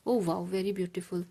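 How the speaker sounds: usual speaking voice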